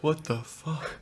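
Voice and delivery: hushed, unvoiced mock disbelief; stunned whisper. Stunned tourists imitated.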